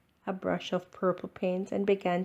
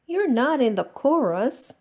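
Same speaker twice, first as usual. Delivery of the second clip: scolding; 5 semitones higher, 5 dB louder than usual. Acted. Ava scolds Max.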